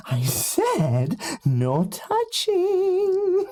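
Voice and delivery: very high, very slow and drawn out; high creepy character voice. Acted. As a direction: stretch it out in a shrill childlike creep voice. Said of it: "and he goes".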